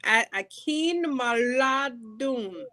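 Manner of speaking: slow, syllable by syllable; slow sounding out. Shown as a demonstration. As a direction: sound the name out slowly. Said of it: "You gotta sound it out slow first".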